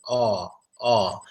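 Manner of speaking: ordinary delivery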